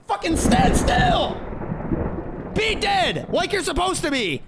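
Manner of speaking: high, strained yell of exasperation; furious screaming. Real, not acted. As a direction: scream orders at an enemy that won't obey. Screaming at the game's enemy.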